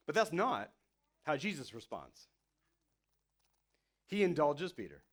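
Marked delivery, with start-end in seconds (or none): none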